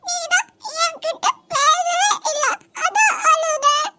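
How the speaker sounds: put-on high, slow sobbing little girl; a hurt child sobbing out her complaint; crying child. Niece sobs to her mother that uncle ignored her.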